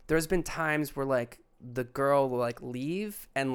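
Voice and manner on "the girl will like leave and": usual speaking voice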